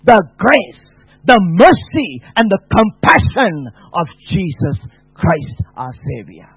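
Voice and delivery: loud, high, slowed proclamation; full-force preaching. Acted. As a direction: proclaim it to the whole congregation.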